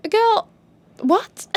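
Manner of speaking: put-on high, disbelieving girl what; can't believe what she heard; high incredulous retort; stunned girl what. Reacting to insult.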